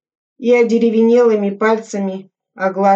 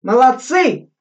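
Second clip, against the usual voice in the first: higher, louder praise; cheerful shout; 6 semitones higher, 5 dB louder than usual. A narrator's reading. Lieutenant praising his men, cheerful shout. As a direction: call out praise proudly. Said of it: "бодро кричал Суслин".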